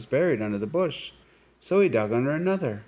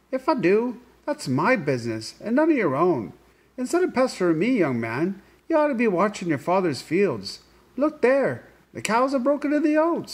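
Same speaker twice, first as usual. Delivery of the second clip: high, louder, sharp little-man voice; sharp leprechaun; 12 semitones higher, 4 dB louder than usual. Acted. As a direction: snap at Tom like a cross leprechaun. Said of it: "answered Tom sharply".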